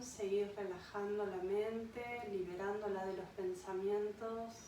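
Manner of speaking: unhurried meditation voice with long pauses; guided meditation. Acted. Yoga guide leads a listener to an imagined beach, guided meditation. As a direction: guide them gently into rest, phrase by phrase.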